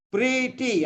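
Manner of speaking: "Pretty" split as pre, then tee; syllable by syllable. Shown as a demonstration. Teacher showing a student two syllables, syllable by syllable. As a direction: break "Pretty" into two separate beats. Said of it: "Two syllables, you see, same thing".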